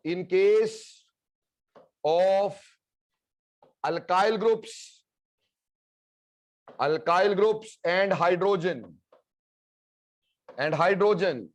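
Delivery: slow dictation with long pauses between phrases; classroom dictation. Real, not acted. Teacher giving students notes on leaving groups, slow dictation.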